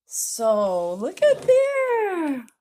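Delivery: high, loud, stretched-out delight; drawn-out thrilled call; excited. Real, not acted. To viewers, showing her name on the book: slow thrilled call. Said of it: "I am just so excited".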